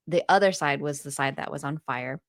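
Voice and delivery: ordinary talk, nothing marked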